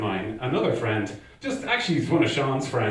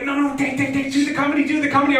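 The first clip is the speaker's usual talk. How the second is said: very high, rising in volume; frantic nagging friend; 13 semitones higher, 3 dB louder, faster than usual. Retold or imitated. Friend won't drop the comedy idea, frantic nagging. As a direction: badger without a break, mock pleading.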